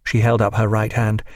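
ordinary delivery

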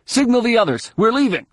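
urgent, high-pitched shouted order; high call across a battlefield; shouting. A narrator's reading. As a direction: shout to get everyone moving. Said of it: "Jason shouted".

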